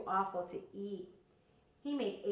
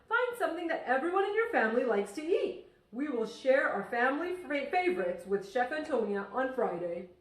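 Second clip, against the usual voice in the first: higher, louder friendly teacher; cheerful teacher voice; 4 semitones higher, 7 dB louder than usual. Acted. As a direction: sound like a beaming teacher inviting the class. Teacher giving class assignment.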